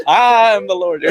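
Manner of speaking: a laughing, lightly done Southern drawl. Retold or imitated. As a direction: say it like God from the American South, half joking. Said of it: "a Southern drawl".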